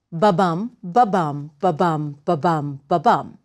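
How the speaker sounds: spaced-out "ba-bum" pulse, BUM stressed; heartbeat rhythm. Shown as a demonstration. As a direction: beat "ba-bum" like a heart, pausing after each pair. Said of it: "mimics the rhythm of the human heartbeat".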